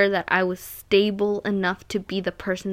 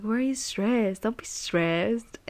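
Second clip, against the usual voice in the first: lightly voiced mentor, softer, the last words slowed way down; imitating mentor; 4 dB quieter, slower than usual. Mentor calming Karen again, soft and slow mock voice. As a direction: drag out don't be stressed like a mellow mentor. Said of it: "That's literally how he talks".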